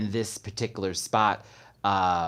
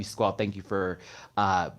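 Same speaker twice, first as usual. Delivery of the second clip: ordinary talk, nothing marked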